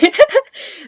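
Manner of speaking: high nervous giggle; nervous laughter. Real, not acted. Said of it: "nervous laughter aside".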